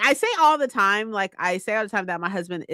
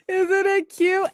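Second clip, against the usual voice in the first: giggly gushing; 10 semitones higher, slower than usual. Real, not acted. Gushing over gift.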